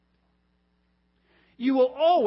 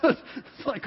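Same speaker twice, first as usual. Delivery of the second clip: high, broken up with laughter; cracking up mid-sentence; laughing; 8 semitones higher, much slower than usual. Real, not acted. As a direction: talk through helpless laughing. Laughing at his own joke.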